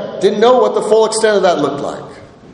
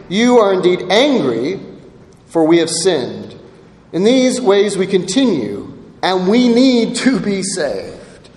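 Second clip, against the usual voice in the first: scripture reading; 4 semitones lower than usual. Acted. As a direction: read the verses like a sacred text.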